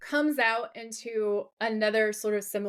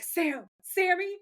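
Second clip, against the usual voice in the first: a hint of a frantic yell, higher and slow; panicked name call; 8 semitones higher, slower than usual. Dean searching for Joe, calling like for Sam: mild panicked shout. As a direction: call the name out like a worried brother, drawn out. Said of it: "shouting her name the way he shouts Sam's name".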